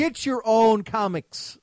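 loud, high, drawn-out rant; indignant, stretched-out complaint; indignant heckle. Real, not acted. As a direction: holler it slowly at the offending actors. Ranting about British actors in roles.

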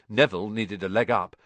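usual speaking voice

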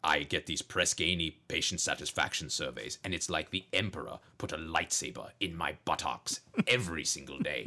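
low Darth Vader rant, rising to angry bursts; Doc Vader rant. Acted. Doctor in Vader voice rants about patient surveys and opioids. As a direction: rant like a masked space villain doctor. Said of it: "he comes out and he's like".

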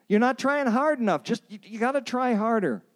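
high-pitched, hammed-up scolding; mock exasperated urging. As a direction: urge them on in a high, exasperated voice. Voicing the advice not to give.

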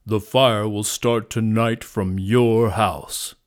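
loud, slow lion growl; ferocious growl. A narrator's reading. Lion accuses the hunter, growling ferociously. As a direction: growl the accusation slowly and heavily. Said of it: "growled the lion ferociously".

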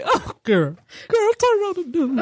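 mock weeping phone call; hammed-up tearful plea; imitated weeping. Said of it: "called me weeping".

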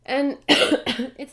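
coughing through a cold; coughing fit. Real, not acted. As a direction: cough breaks into the words. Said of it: "Sorry, I'm gonna be coughing".

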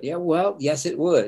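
high, quick retort; playful contradiction. Retold or imitated. Son contradicting his dad in mock argument, high retort. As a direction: snap back a mock-stubborn yes.

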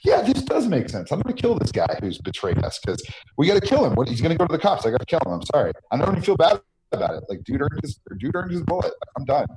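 callous player. Retold or imitated. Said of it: "you're gonna be like".

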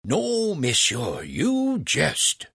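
high, swooping astonishment; exclaimed disbelief. A narrator's reading. Franklin hears of Legras's instrument, exclaiming in disbelief. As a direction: act amazed to egg him on. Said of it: "Franklin exclaimed".

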